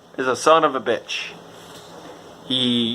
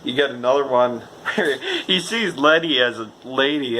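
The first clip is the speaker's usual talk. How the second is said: usual speaking voice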